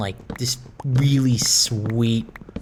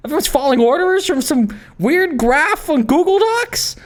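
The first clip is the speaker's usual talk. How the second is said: mock disbelief; 8 semitones higher than usual. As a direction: play up the shock for laughs. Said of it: "I'm playing it up for the bit".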